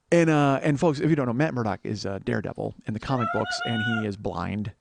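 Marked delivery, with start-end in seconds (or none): none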